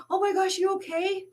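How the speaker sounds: high, frightened oh my gosh; panicked concern. Retold or imitated. Kid asking the janitor if he's hurt, high and scared. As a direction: rush to check he's alright in a high scared voice.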